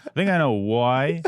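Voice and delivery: put-on teasing copy of an Irish accent; mock Irish accent. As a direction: exaggerate the Irish vowel in WHY as "woy". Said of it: "It's not w".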